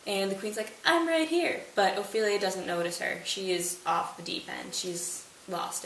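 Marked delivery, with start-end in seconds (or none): 0.8-1.6 high eager queen. Retold or imitated. Queen to Ophelia who ignores her, high and eager. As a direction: wave for attention with a high voice.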